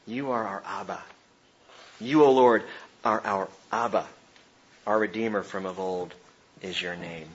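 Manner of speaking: soft, low, reverent address to God; tender prayer. Acted. Preacher rereads Isaiah's words to Abba, tender prayer. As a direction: speak it gently, as a child to Daddy.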